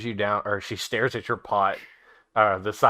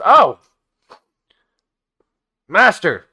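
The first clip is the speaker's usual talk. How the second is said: loud, higher eager cry; excited goblin; 7 semitones higher, 12 dB louder, slower than usual. Acted. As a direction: greet the master like a thrilled servant. Large goblin greets master.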